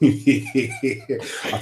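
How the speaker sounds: slow, laughing through words. Real, not acted. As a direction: chuckle as you begin. Laughing start.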